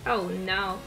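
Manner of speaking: usual speaking voice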